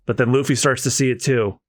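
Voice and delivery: ordinary talk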